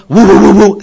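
loud, high burst of woofs; dog barking. Acted. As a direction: bark eagerly like a dog. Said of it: "now he barks".

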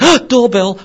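loud, high gasp of recognition; startled dog. Acted. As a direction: react as a dog hearing the bell. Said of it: "the dog thinks".

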